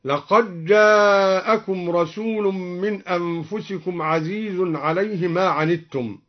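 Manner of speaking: لقد جاءكم merged as "laqaj-jā'akum"; fuse the "d" of لقد into a doubled jim in جاءكم; idgham. Shown as a demonstration. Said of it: "وأدغم الدال في الجيم". (إدغام دال لقد في جيم جاءكم)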